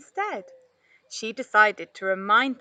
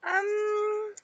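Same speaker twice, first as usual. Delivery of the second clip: shocked um; 12 semitones higher than usual. A narrator's reading. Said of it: "sounding shocked".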